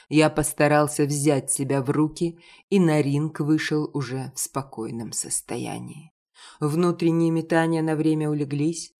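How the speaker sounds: ordinary delivery, nothing marked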